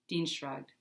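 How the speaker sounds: plain reading voice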